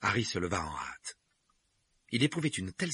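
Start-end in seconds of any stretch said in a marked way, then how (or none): none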